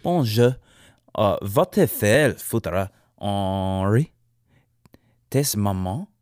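lightly put-on French accent, slow and drawn out; mock French accent. Podcaster cursing Hurricane Henri in a lightly put-on French accent. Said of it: "I gotta do that with a French accent".

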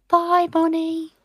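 high, slow sing-song goodbye; puppy talk. Real, not acted. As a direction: coo it at a puppy.

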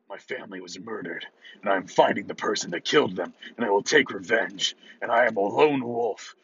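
hammed-up brooding loner voice; low gravelly dry calm. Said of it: "and I talk in a grizzled voice".

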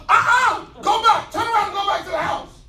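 angels shouting. Acted. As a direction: bark it like angels blocking the way. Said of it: "and the angels are".

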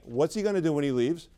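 normal talking voice

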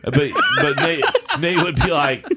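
talking through laughter, high and loud; laughing. Real, not acted. Telling friends about Nate, laughing loudly. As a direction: laugh while getting the words out. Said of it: "laugh heard".

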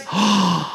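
sharp gasp of amazement; astonished gasp. Acted. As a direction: gasp as if the rabbit just appeared. Acts the astonished face.

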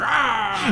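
high, imitated monster "Ah!"; mock roar of a ghost bursting out; ghost pirate roar. The Flying Dutchman comes out of his quarters, mock roar. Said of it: "out comes the Flying Dutchman".

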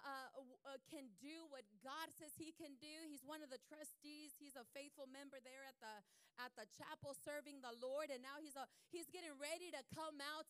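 normal talking voice

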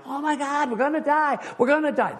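high panicked wail; shaky stammering panic. Acted. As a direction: fishermen sure they will drown. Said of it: "losing their ever-loving mind".

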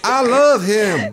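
high, slow hammed-up devotion; inmate's devoted sweetheart. Lady defending jailed lover, mock devotion. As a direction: declare it melodramatically, drawing out each word. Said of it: "I don't know why they have that accent".

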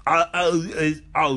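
normal talking voice

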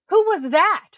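high, loud nosy question; pry like a suspicious wife. Retold or imitated. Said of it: "And she goes".

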